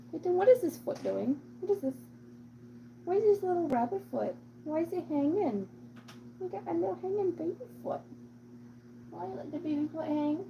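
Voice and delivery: high-pitched cooing questions; doting, high cutesy voice. Real, not acted. Baby-talking the cat about its foot.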